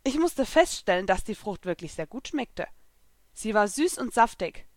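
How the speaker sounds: plain reading voice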